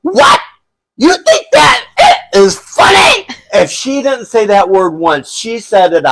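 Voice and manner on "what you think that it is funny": high, loud, slow indignant shriek; mom scolding. Retold or imitated.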